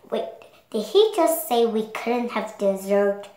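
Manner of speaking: ordinary delivery, nothing marked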